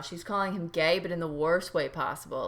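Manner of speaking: normal talking voice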